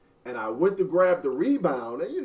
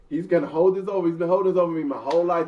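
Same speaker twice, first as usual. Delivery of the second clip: usual speaking voice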